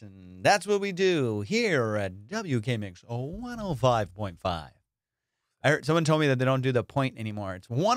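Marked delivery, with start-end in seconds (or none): 0.0-4.7 a lazy radio host purring the call letters. Shown as a demonstration. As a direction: croon the station call like a late-night DJ. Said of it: "back to the radio voice".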